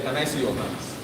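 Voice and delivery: normal talking voice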